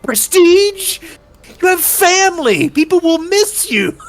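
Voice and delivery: mock outraged high voice scolding a duelist; incredulous high voice. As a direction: squeak it out in disbelief. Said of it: "And he's like".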